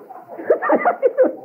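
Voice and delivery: high burst of real laughter; laughing. Real, not acted. Preacher laughing at his own story. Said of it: "saya ketawa".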